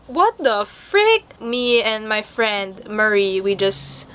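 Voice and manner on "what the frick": slow, high, drawn-out incredulity; dragged-out "what the frick"; slow disbelief. Retold or imitated. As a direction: stretch out the baffled question. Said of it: "we were just like".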